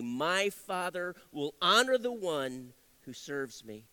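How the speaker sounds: slow, deliberate scripture reading; measured reading from the pulpit. Acted. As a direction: read the verse slowly, with weight. Reads scripture aloud.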